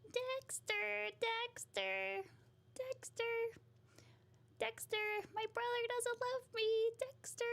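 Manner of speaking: very high, nasal cartoon voice; high-pitched nasal sister calling out; Dee Dee. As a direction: whine it way up high through the nose. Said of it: "Dee Dee's voice from Dexter's Laboratory. She's, like, she's really nasally".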